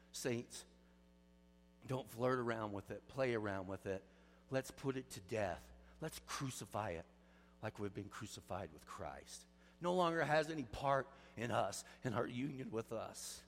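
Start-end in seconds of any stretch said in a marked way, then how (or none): 0.1-9.5 low, soft pleading to the saints; hushed earnest appeal; soft pastoral plea. Real, not acted. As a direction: draw them in gently, much lower and softer. Tender pastoral plea to congregation.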